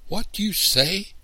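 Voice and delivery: very high, loud, shocked question; disbelieving. A narrator's reading. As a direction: demand it in sheer disbelief. Cannot believe Papa said no.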